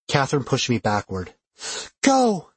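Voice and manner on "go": usual speaking voice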